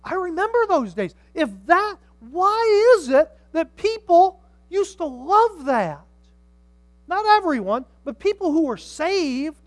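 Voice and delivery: high, loud, emphatic pulpit delivery; impassioned preaching. Real, not acted. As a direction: drive each phrase home to the congregation. Preaches at raised pitch and volume.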